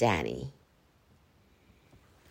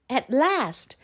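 usual speaking voice

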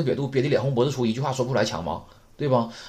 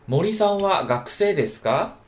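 model reading; 7 semitones higher, 4 dB louder than usual. Shown as a demonstration. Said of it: "我们把这例子再读一下".

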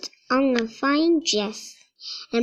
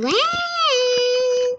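much higher, pleading repeat of When; impatient child; 11 semitones higher than usual. Acted. Young brother begs to know when Mulan comes, impatient. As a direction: push When up high like an eager kid.

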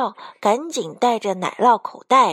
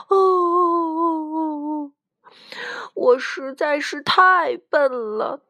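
put-on old grandpa lament rising into a tearful wail; hammed-up crying old man; acted wailing; 6 semitones higher, slower than usual. A narrator's reading. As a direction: start slow, then climb into high, self-pitying sobs. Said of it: "故事爷爷嚎啕大哭起来".

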